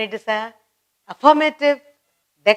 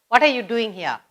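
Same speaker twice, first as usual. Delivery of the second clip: usual speaking voice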